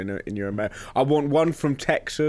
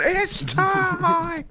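much higher hype announcer shout; announcer call. Pretend. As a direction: launch the show like a hype host. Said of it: "Are we recording yet?".

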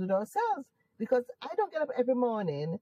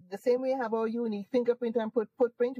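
ordinary delivery

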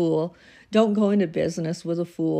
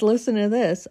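usual speaking voice

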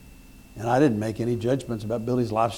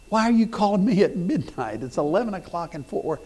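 high-pitched incredulous questioning; retold disbelief, pitched up; baffled; 6 semitones higher than usual. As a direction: act out bewildered protest at a late caller. Acts baffled at midnight call.

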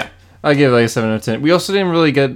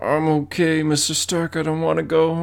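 soft, pleading mock-dying voice; weakened Superman; 2 semitones higher, 7 dB quieter than usual. Joking to co-host as a fallen Superman, faint and pleading. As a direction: play faint and apologetic, very quietly. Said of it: "Superman's just like".

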